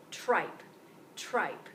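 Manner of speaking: "tripe" with a crisp added T; backward buildup step. Shown as a demonstration. Teacher models "tripe" for the class. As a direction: model "tripe", tr blended firmly at the start. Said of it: "now add the T".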